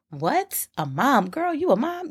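mock surprise. Mock disbelief at own statement.

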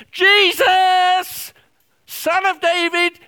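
desperate shout, high and loud; crying out. Acted. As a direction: yell his name with everything. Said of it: "he cries out and he says".

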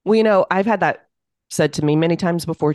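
ordinary talk

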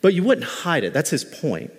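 ordinary delivery

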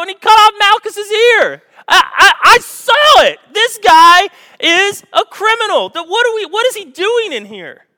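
high, loud shouting accuser pointing him out to the crowd; accusing servant. Acted. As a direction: yell it to the whole courtyard, outraged. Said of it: "a guy stops him at the door and goes".

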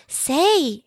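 mouse voice. A narrator's reading. Ralph the mouse speaks.

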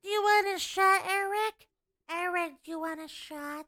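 inhaled ditzy-blonde voice calling out; playful character voice, air sucked in; put-on character voice. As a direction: offer it as a dumb-blonde alter ego. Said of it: "doesn't sound like you at all".

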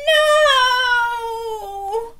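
dismayed cry. Acted. Miranda protesting.

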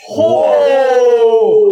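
sudden loud startled cry; surprised shout. Real, not acted. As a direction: yelp at the damage. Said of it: "It's very little lah".

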